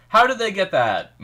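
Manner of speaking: high, loud disbelief; surprised. Real, not acted. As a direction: blurt it out in astonishment. Reacting to a surprising scene.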